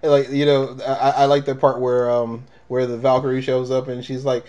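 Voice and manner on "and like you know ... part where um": usual speaking voice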